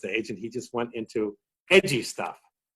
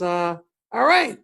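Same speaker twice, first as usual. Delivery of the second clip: high, slow sheepish sign-off; mock sign-off; 7 semitones higher, 5 dB louder, slower than usual. Bob cut short, sheepish slow sign-off. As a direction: drag it out like a comic giving up. Bob leaving stage, retold.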